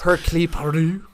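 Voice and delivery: stumbling attempt at "Hercule Poirot"; attempted French name. Real, not acted. Host trying a French name he can't say, attempted pronunciation. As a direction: have an unsure go at saying "Hercule Poirot". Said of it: "I can't, actually, can you say it?".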